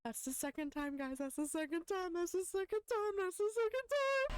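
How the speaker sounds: rising disbelief. Real, not acted. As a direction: build from stunned to shrieking. Disbelief building after close call.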